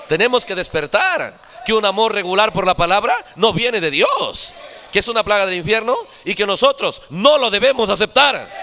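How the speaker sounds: loud, high, impassioned exhortation; full-force preaching. Real, not acted. Preacher urging the congregation to reject a plague, full-force preaching.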